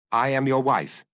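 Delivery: a lightly voiced cold, menacing woman; mild menace, a touch of metal; understated threat from a strange woman; metallic menacing voice. A narrator's reading. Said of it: "in a metallic, menacing voice".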